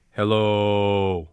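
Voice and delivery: very low hello, hold out the O; low held hello. Shown as a demonstration. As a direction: rumble a long low hello. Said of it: "from that place, say".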